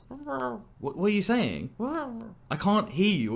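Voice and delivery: like straining on a crackly call; muffled phone voice. Pretend. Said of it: "a muffled voice as if someone's talking on the phone".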